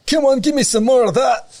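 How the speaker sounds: high, loud hammed-up Scottish voice; Scottish accent. Camphor saplings taunting the farmer, hammed-up Scottish accent. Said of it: "I don't know why I went for Scottish one there".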